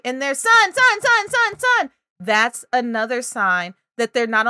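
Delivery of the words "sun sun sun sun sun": put-on high, loud toddler chant; a little one fixated on one word; child repeating. Shown as a demonstration. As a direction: chant it over and over like an insistent toddler.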